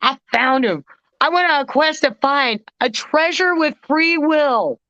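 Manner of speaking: high, lively telling of the find; excited disbelief. Real, not acted. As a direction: burst with giddy pride. Euphoric retelling of the find.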